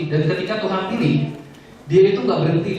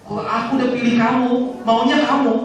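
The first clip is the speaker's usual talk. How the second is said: God insisting; 8 semitones higher than usual. Retold or imitated. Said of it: "dikejar ya sama Tuhan".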